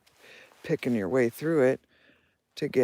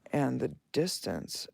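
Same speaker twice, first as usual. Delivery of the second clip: normal talking voice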